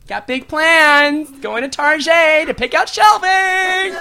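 high, slow, drawn-out cheer; mock overjoyed coworker; super positive. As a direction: gush it far too brightly. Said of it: "like, super positive, like, too positive".

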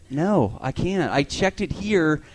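slow, spelled-out patience; slow exasperated explaining. Retold or imitated. Comic explains to the wrong-number caller, slow and exasperated. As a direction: talk down to her slowly.

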